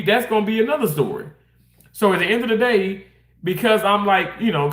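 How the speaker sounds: usual speaking voice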